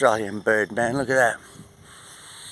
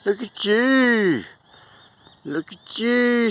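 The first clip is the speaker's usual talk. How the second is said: slow sing-song admiration; slow high coo; 10 semitones higher, 3 dB louder, slower than usual. Real, not acted. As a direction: coo it slowly like talking to a pet. Cooing at a bird on fence.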